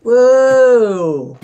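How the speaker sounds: high, loud, long cry; drawn-out yell of amazement; startled whoa. Real, not acted. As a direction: holler out in shock. Startled during climbing game.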